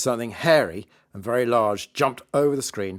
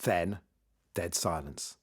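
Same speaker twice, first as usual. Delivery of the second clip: plain reading voice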